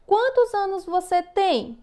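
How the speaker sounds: high, lively question tune rising on "Quantos anos você tem?"; asking question demo. Shown as a demonstration. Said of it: "Vamos ver aqui um exemplo".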